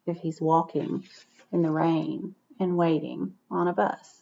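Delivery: put-on soft, chatty aside to young listeners; questions to the children. Reader pausing the story to quiz children, softly. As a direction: ask the kids gently, like at story time.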